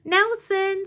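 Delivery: high, loud call of a name. A narrator's reading. Sarah calling Nelson over, high and loud. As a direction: call his name across the room.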